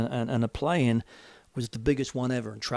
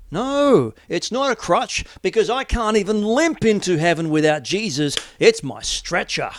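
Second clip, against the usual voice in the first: preacher's raised rebuttal, higher and louder; loud, high preaching denial; forceful rebuttal; 6 semitones higher, 7 dB louder than usual. Acted. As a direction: throw the objection back at them. Rebutting the crutch taunt.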